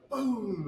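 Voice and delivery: imitated gunshot boom. Telling how a blank startles the crew, booming imitation. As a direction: punch out a long, falling boom.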